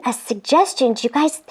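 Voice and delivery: usual speaking voice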